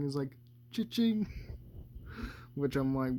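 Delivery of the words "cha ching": higher-pitched, mock ad-style catchphrase; imitated commercial jingle. Cat quoting a theme park plug, mock commercial voice. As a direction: mimic a cheesy commercial punchline, pitched up. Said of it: "commercial ad or something".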